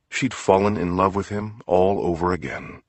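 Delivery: ordinary delivery, nothing marked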